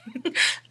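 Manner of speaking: breathy little giggle; light laugh. Real, not acted. Reader giggling at her own message, light laugh. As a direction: giggle at yourself.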